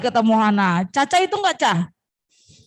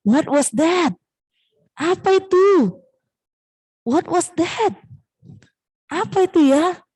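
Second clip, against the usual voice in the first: higher, drawn-out puzzled question; startled wondering; 6 semitones higher, slower than usual. Acted. Little mouse hears a noise at night, mildly startled. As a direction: wonder aloud at a strange noise.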